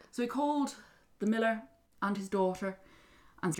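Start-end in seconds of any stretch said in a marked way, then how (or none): none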